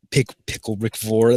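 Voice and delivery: plain reading voice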